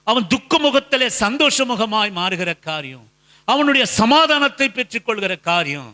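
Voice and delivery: high-pitched, driving sermon cadence; emphatic preaching. Real, not acted. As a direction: preach it in rising, insistent parallel phrases. Preaches at full force. (உணர்ச்சி பொங்கும் உரத்த பிரசங்கம்)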